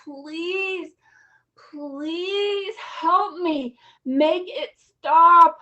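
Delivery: put-on slow, pleading little girl's prayer; drawn-out begging plea, please help me; a small girl pleading with God to make it stop; child begging God. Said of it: "I was begging God to help me".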